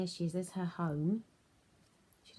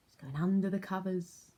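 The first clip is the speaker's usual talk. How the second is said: ordinary delivery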